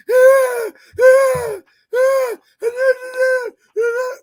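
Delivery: exaggerated, high, loud mock sobbing wail; mocking a coach crying on TV with a loud wail. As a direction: ham up a grown man bawling. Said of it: "I was just mimicking him".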